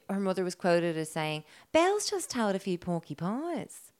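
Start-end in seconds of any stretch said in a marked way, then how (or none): 1.7-3.9 drawn-out, higher-pitched Australian quote; Australian accent. Acted. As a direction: quote the mother in broad, slow Australian. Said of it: "I love when you do an accent".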